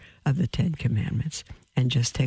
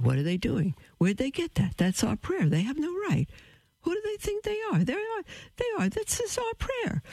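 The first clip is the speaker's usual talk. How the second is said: building from mild protest to a much higher, indignant cry; retold horrified outrage. Recalling her outrage at hearing her people's prayer, building high and indignant. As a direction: let the shock climb into high-pitched outrage. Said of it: "I was horrified".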